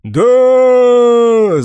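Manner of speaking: loud high shout. Acted. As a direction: yell it as a crowd. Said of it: "закричали другие ветераны".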